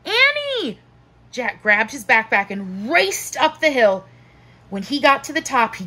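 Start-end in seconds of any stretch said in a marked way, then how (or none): 0.1-0.8 high-pitched call; high cry of the name. A narrator's reading. Boy calling out in alarm.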